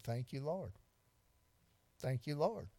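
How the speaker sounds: soft thankful prayer. Retold or imitated. Preacher retelling his prayer after missing a deer, softly grateful. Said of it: "I said".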